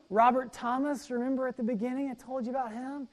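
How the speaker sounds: normal talking voice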